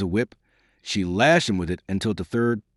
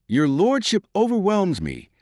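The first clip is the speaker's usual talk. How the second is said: higher, mock-grave courtesy; grave irony; 10 semitones higher than usual. A narrator's reading. Carroll to the bewildered Earl, gravely ironic. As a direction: feign being honoured, understated irony. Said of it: "said Mister Carroll gravely".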